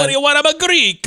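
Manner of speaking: put-on loud, high comic Greek accent; mock Greek accent. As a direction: boom it in a hammed-up Greek accent. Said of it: "is it okay to do the Greek accent?".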